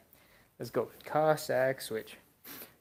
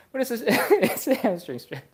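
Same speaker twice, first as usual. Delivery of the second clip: laughing; 10 semitones higher, 9 dB louder than usual. Real, not acted. Laughs at his own phrasing.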